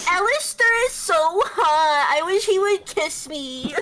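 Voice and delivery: put-on high, loud gushing girly voice; quirky girl voice. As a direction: squeal it like a swooning fangirl. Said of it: "you want the quirky girl voice?".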